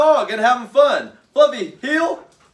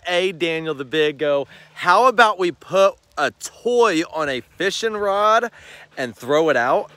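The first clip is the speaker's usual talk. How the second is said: dog's voice; 7 semitones lower than usual. Pretend. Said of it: "the Fluffy Poodle just whispered in my ear".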